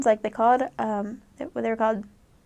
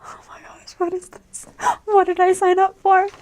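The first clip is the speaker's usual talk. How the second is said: panicked; 8 semitones higher, 5 dB louder than usual. Retold or imitated. Missionary during an earthquake, rising from whisper to high alarmed cry. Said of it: "and I'm just like".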